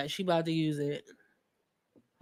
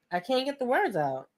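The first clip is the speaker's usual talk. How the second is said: normal talking voice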